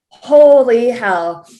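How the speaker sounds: slow, drawn-out loud amazement; stunned. Retold or imitated. Guest recalls hearing the voicemail, stunned and drawn out. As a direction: stretch each word in disbelief. Retold shock at viral voicemail.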